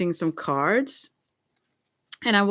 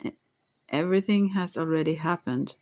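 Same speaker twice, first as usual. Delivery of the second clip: ordinary talk, nothing marked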